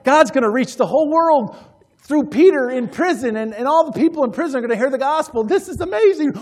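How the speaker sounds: high, loud excitement tumbling onward; acted jubilation. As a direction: play believers thrilled at the opportunity. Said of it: "An attitude of opportunity".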